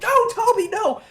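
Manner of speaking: high, loud cry of alarm; high-pitched mock shriek of shock; panicked yell. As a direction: scream as the audience sees him stabbed. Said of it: "we all freaked out".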